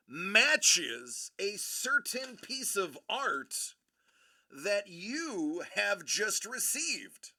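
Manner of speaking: slow, grand reveal voice; dramatic announcer. Acted. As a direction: announce the prize slowly, like a game show host.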